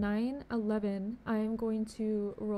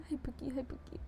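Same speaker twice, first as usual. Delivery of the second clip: whisper; 2 semitones higher, 7 dB quieter than usual. Real, not acted. The same greeting repeated.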